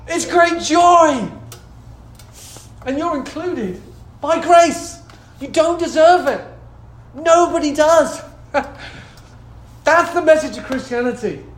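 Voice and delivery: loud, high proclamation; fervent preaching. Real, not acted. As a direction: declare it joyfully to the congregation. Preaches with raised voice.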